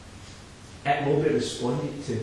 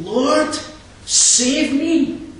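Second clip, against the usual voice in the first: high, loud cry to God; sinner's plea; 12 semitones higher, 9 dB louder, much slower than usual. Acted. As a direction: cry out for rescue like a desperate sinner. Preacher voices sinner's plea.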